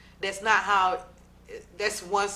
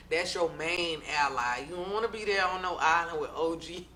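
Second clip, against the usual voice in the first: ordinary talk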